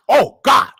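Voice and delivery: normal talking voice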